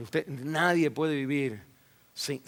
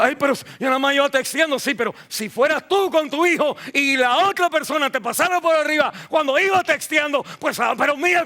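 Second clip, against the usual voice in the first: higher and louder, pressing the point; fiery preaching; 10 semitones higher, 7 dB louder than usual. Acted. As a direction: drive it home to the congregation. (predicación enérgica, más alta y fuerte)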